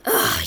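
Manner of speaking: disgusted groan. Real, not acted. Disgust at next show on list.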